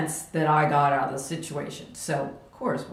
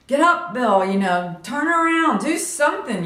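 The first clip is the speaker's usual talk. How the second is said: louder, higher calls to get up; urging shout; 6 semitones higher, 4 dB louder than usual. Retold or imitated. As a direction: yell encouragement at a downed brother. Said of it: "trying to encourage my brother".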